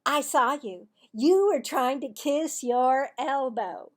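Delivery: loud, high gotcha; accusing girl. Acted. Cassie to Marvin, loud accusing tattle. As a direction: point the finger, triumphant.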